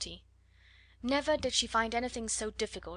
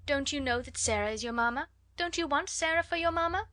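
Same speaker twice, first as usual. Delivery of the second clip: higher, bright coaxing questions; cheerful; 6 semitones higher, 3 dB louder than usual. A narrator's reading. Sarah cheerfully comforting little Lottie, lightly voiced. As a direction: cheer up a crying child. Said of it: "said Sarah cheerfully".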